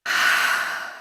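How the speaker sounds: long, soft breath out; soft, airy sigh; demonstrated exhale. Shown as a demonstration. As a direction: let the air go gently. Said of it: "breathe in through your nose".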